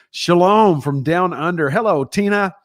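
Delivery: greeting a faraway viewer, loud and cheerful; excited greeting. Real, not acted. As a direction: sing out a warm welcome.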